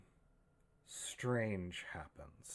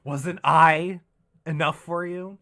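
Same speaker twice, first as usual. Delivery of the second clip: screaming; 8 semitones higher, 20 dB louder than usual. Acted. Knife-wielding woman screams betrayal.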